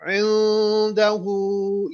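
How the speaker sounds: इंदहू with the final hu stretched very slowly; long madd. Shown as a demonstration. Teacher models the madd, इंदहू drawn out long. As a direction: draw out the u of इंदहू, two counts. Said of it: "ये मद है यहां पर ना".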